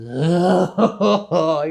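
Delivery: long, loud, high-pitched groan; exasperated groan. Acted. Acts out Peter groaning at news.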